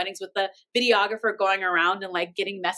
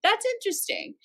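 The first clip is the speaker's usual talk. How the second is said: skeptical; 11 semitones higher than usual. Retold or imitated. Retold skeptical reaction.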